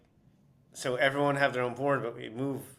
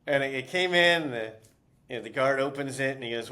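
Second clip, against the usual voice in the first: ordinary talk, nothing marked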